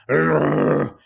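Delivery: loud grunt in a put-on voice; mock caveman grunt. Tom answering the speaker with a caveman grunt. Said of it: "and he goes".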